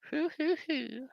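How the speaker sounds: very high breathy hoo-hoo; high soft hooting laugh. Pretend. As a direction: hoot softly like an owl.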